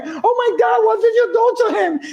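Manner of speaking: mock shocked bystanders. Bystanders shocked after the fight.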